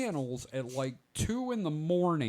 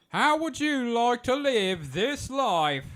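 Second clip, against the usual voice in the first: higher, louder, slow plummy TV host voice; Robin Leach; 9 semitones higher, 6 dB louder than usual. Retold or imitated. Recalling late-night TV to friends, a higher louder slow showman voice. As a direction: roll it out like a lavish TV showman. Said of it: "sound like Robin Leach from Lifestyles".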